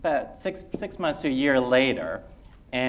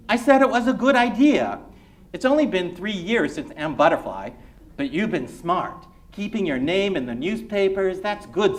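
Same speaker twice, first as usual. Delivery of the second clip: raised, pleased dad voice; a father praising his son, a bit loud; proud dad, lightly voiced; proud immigrant father; 7 semitones higher, 5 dB louder than usual.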